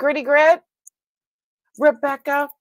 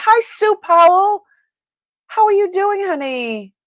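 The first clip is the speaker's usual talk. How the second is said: much higher, louder welcome; excited greeting; 10 semitones higher, 7 dB louder than usual. Real, not acted. Streamer warmly greeting a regular viewer, high and loud.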